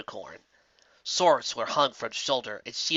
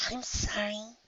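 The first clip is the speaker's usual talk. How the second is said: higher, softer filly apology; a small, sheepish, half-whispered sorry; 9 semitones higher, 6 dB quieter, slower than usual. Acted. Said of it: "she half whispered".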